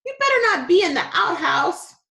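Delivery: put-on high, loud calling for her boy; mom shouting. A narrator's reading. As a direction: yell it from the back door.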